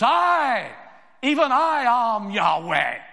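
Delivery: high, loud proclamation as God; God declaring his own name, booming. Acted. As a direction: thunder it out as the Almighty.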